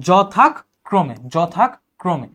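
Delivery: যথাক্রমে with a pause after "jo-thak"; split pronunciation. Shown as a demonstration. Teacher modelling the conjunct, যথাক্রমে broken before krome. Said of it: "ক্রমের আগে তুমি থামছো".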